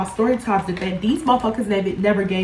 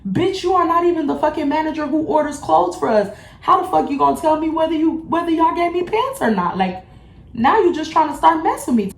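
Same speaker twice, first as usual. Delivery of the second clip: high-pitched retold telling-off; indignant retort; 8 semitones higher than usual. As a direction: argue back, pitched high and outraged. Acted argument with coworker.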